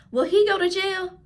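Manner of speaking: put-on high, quick child voice; little boy's question. Josh asking his mother about jail, high child voice. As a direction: pitch up like a worried small boy.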